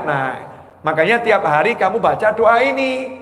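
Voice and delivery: ordinary delivery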